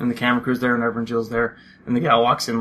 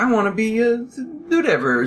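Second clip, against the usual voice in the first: put-on a touch of a wide-eyed teen voice, higher; mock eager teen; 10 semitones higher than usual. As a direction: chirp it like a hopeful teen. Imitating eager reality-show teens.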